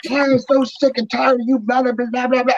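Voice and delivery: high, hammed-up tirade dissolving into blahs; retold angry rant. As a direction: imitate someone ranting on and on. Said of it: "he just kept going and going".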